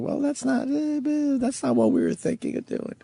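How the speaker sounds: high mock backpedaling stammer. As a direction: nervously back out of it in a high voice. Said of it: "backpedaling and saying".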